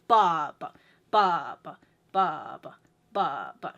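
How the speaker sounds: long strong "ba", short low weak "ba"; strong-weak two-four. Shown as a demonstration. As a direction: chant BA-ba in pairs. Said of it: "first downbeat is your strong beat".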